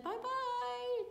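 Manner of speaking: high sing-song farewell; angel bye. Acted. Angel waving goodbye to shepherds, high sing-song. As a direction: trill a cheery goodbye. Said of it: "the angel said".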